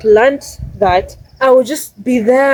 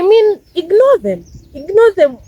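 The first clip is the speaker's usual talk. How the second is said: pitched up, pressing the point; insistent urging; 8 semitones higher than usual. Real, not acted. Urging viewers to ignore bitter strangers, insistent.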